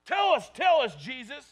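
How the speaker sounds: loud, high, demanding shout; pressing Pharisees. Retold or imitated. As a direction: shout it at him like a pushy crowd. So they keep pressing him.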